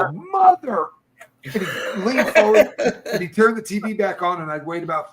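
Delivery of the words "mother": high, loud mock yell of exasperation; frustrated yell. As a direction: imitate a man cursing at his TV. Prisoner cursing as his TV dies.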